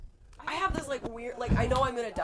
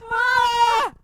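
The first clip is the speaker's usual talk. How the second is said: high, loud drawn-out scream; panicked scream; 14 dB louder than usual. Retold or imitated. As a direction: scream in mock terror.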